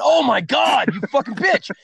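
retold furious cry; outraged. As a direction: imitate yelling at her. Retold outburst at girlfriend.

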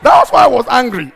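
shouted and loud; loud indignant cry; angry outburst. Real, not acted.

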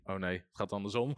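a demon realizing it is losing, muttered low and soft; dismayed realization. Retold or imitated. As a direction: mutter the dawning defeat under the breath. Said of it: "dacht hij".

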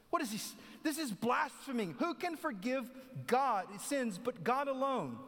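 high, slow outraged protest; indignant scribes. Acted. Scribes questioning Jesus, high and scandalized. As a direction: gasp at the blasphemy. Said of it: "questioning in their hearts".

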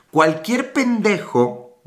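each word drawn out, very slow; slow recitation. Shown as a demonstration. Quoting a saying to viewers, very slowly.

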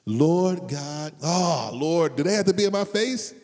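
loud, high mock groan to the Lord; preacher playing an annoyed believer; exasperated cry. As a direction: cry out to God in fake exasperation.

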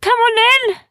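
very high, loud shout; high-pitched desperate yell; urgent creature call. Acted. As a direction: holler for a friend to board. Sniz calls from the airship.

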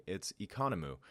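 Economou said clearly as ee-KAH-noh-moo; name pronunciation. Shown as a demonstration. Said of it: "And if you're wondering".

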